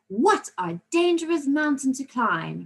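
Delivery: slow, high, emphatic exclamation; enthusiastic exclamation. Shown as a demonstration. Teacher showing pupils how exclamations sound, enthusiastic. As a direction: exclaim it with drawn-out, demonstrated enthusiasm. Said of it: "with quite a lot of enthusiasm".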